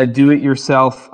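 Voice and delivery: normal talking voice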